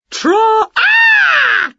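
singing broken off into a very high scream; startled shriek. A narrator's reading. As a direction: shriek loudly in sudden fright. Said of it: "they shrieked as they suddenly saw".

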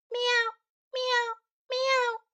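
very high cat mewing; high little kitten meows; meowing cat. Acted. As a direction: mew like a small kitten. Cat answers boy.

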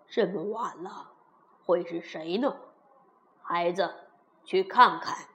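low-pitched fatherly king; puzzled king. Acted. King asks daughter who is knocking, puzzled. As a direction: play a low-voiced king wondering aloud.

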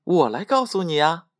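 high-pitched little shout; mild storybook holler, pitched high; calling out loudly. A narrator's reading. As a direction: pipe up to get their attention. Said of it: "马上的大声喊叫道".

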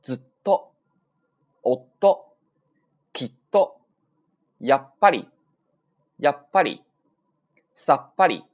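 loud word list, each like きっと split by a silent held beat; sokuon word drill. Shown as a demonstration. Teacher runs all the words from the top, emphatic drill. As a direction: punch out ずっと, おっと, やっぱり with a stop before the last part. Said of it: "从头来一遍啊".

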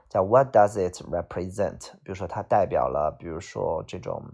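normal talking voice

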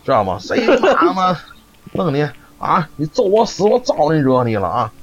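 put-on high-pitched scolding old woman; mock quarrel. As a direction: scold like a cranky granny in the hutong. Retold old woman cursing neighbor. (高声骂街的老太太)

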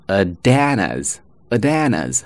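"Adanas" as uh-DAN-uz, t turned to d; linked weak form. Shown as a demonstration. Teacher showing at before a vowel, linked with d. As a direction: join at to Anna's as one word, "Adanas". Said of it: "所以呢，前面读为".

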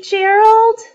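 high, loud name call; gleeful high-pitched call; excited calling. Acted. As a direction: sing out the friend's name. Pig gleefully asks her friend.

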